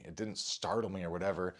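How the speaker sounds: ordinary talk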